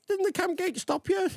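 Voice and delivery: high, disbelieving mock officer voice; squeaky, stunned questioner; incredulous officer. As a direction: act out the baffled officer asking about the tank thief. Retold army yarn, questioning quote.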